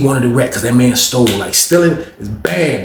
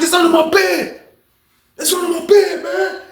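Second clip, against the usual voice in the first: much higher, shouted begging; panicked pleading. Retold or imitated. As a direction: plead in panic to make it stop. Lou begs while being beaten.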